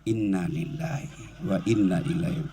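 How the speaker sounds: low, soft, walking-away murmur. Shown as a demonstration. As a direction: murmur it quietly as you leave. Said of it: "lalu kita tinggalkan".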